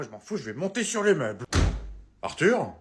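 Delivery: usual speaking voice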